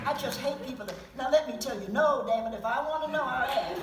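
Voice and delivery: loud, fed-up snap at meddlers; exasperated outburst. Real, not acted. Speaker venting at people who give opinions, loud and exasperated. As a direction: push them off, you don't want their opinion.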